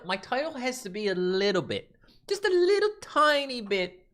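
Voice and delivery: high-pitched, slowed wheedle; mock-defensive squeak; playful pleading. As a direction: go tiny and high to excuse yourself. Playfully pleading about his title.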